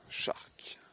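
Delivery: single whispered word; soft, voiceless whisper; hushed reveal. Real, not acted. Reading out the name, hushed reveal.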